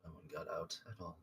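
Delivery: low, very soft reply; quiet conversing. A narrator's reading. Blue Dragonborn answering the muttered question quietly, overheard. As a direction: answer in a hushed, sinking voice. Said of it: "quietly converse".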